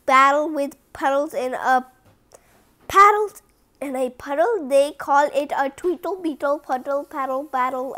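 ordinary delivery, nothing marked

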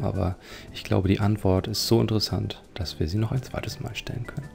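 usual speaking voice

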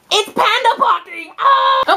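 high excited squeal; over-excited shriek at the end; too excited. Real, not acted. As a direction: burst out gleefully on the reveal. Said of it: "I got a little too excited about this".

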